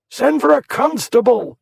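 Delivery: high, loud panicked scream. A narrator's reading. Warbury screaming for help from his carriage, panicked scream. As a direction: scream for help over the crowd. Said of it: "he screamed".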